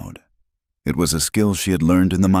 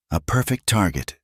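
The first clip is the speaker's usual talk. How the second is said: plain reading voice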